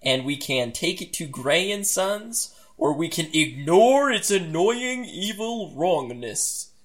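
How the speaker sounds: slow, swooping reading of the options; mock-dramatic narrator, drawing out the final words; dramatic story narration. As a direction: pose the choice with hammy relish.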